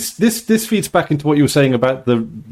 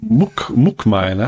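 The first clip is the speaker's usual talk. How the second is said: slow northern mook, mook miner; drawn out northern British Muck miner; northern British accent; 3 semitones lower, slower than usual. Shown as a demonstration. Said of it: "pronounce it in a northern British accent".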